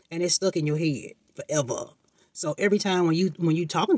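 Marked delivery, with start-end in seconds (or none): none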